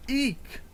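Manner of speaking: sudden high yelp eek; shout eek. Acted. Character squeals in fright at the enemy, high yelp. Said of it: "Why did you just shout eek".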